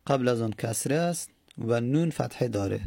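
ordinary talk

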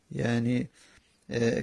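usual speaking voice

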